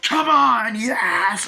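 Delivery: very loud, high triumphant yell; over-the-top shout. Retold or imitated. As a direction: yell like a crazed driver racing a train.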